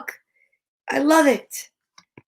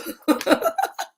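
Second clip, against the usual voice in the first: high laugh; 11 semitones higher than usual. Real, not acted. Breaks up after loud ooh.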